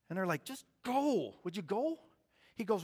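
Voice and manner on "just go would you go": brushing him off, pitched up; annoyed older brother. Acted. The brothers telling David to leave, mildly exasperated. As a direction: shoo the kid away.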